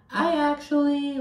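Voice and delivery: high, slow, hesitant imitation; high mock Meredith voice. Recapping Meredith on the show, voiced high and unsure. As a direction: mimic Meredith starting to answer, high and unsure. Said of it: "She is like".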